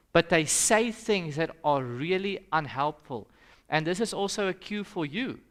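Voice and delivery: normal talking voice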